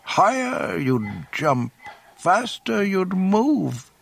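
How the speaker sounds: high, drawn-out, goading creature voice; Yoda. A narrator's reading. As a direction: goad the student slowly, pitched high. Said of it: "Yoda's goading words".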